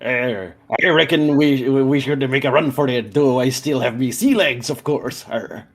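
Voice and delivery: lightly voiced pirate accent; a hint of an old sea dog pirate. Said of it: "stereotypical pirate".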